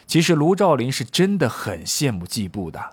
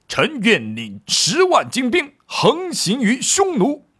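high, drawn-out acted roar of a general; 樊哙怒吼; 10 semitones higher, 3 dB louder than usual. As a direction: pledge it slowly and fiercely, pitched high. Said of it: "上将军樊哙怒吼叫道". (将军怒吼，高亢而拖长)

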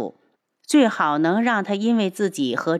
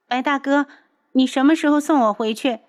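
put-on higher, louder sulky girlish voice; petulant young girl; 8 semitones higher, 4 dB louder than usual. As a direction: whine like a spoiled young girl. Liu'er's voice.

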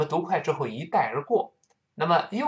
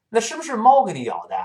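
对话角色发问；音调抬高、语速加快地追问；扮演另一个人关切地问；另一个人追问咬伤来历，语调高扬而快; 8 semitones higher, 5 dB louder, faster than usual. Acted. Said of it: "另一个人就问了".

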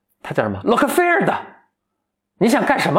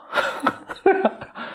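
soft airy giggle; real soft laugh; breathy laugh; 6 dB quieter than usual. Real, not acted. Teller laughs.

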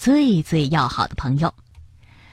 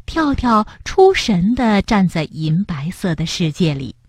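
usual speaking voice

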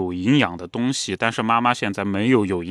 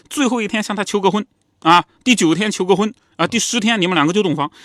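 mother's voice pitched much higher, scolding, pushy; hammed-up scolding mum, rushing at the end; mother flaring up; 10 semitones higher, 4 dB louder than usual. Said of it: "妈妈就火了".

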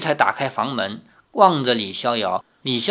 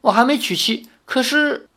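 higher-pitched, flustered protest; hasty denial; 9 semitones higher than usual. A narrator's reading. Suitor assuring grandmother he is unmarried, hasty denial. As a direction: deny it at once, voice lifted. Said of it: "李逍遥连忙说".

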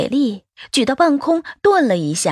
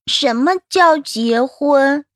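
put-on slow, higher childlike voice; curious little child; 4 semitones higher, much slower than usual. Child asking the adults about marriage, slow and curious. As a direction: ask like a small child, drawing it out. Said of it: "暖暖忽然仰起头问".

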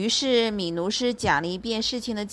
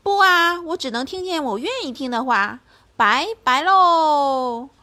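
put-on sly old bear; 5 semitones higher, 4 dB louder than usual. Bear mocks the lion.

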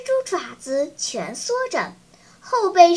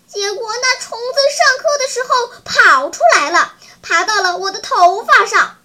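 loud, high-pitched scolding; indignant; 5 semitones higher, 6 dB louder than usual. A narrator's reading. Lucy berating Daqi over his escaped caterpillar, indignant. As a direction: complain hotly, higher and louder. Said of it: "Lucy瞪着眼睛说".

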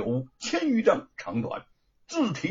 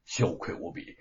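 usual speaking voice

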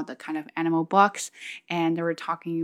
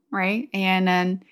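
usual speaking voice

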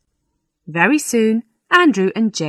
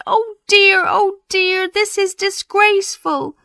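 distressed parson. Acted. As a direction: wail in dismay at a disgrace. Parson appalled at the scandal.

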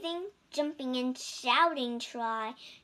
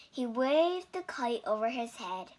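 ordinary delivery

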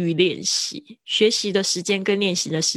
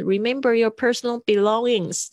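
mock announcement; 4 semitones higher than usual. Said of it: "安检处也会这样子".

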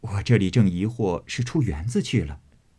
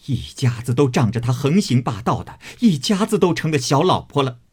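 angry scolding; 6 semitones higher than usual. Acted. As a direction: rage at the whole family. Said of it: "指着他骂道". (气愤地痛骂)

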